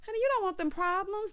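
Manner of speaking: high, knowing honey-warning; sassy warning. Acted. Warning Sabrina away from a shifty man, high and sassy. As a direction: lift it up and warn her off, sister to sister.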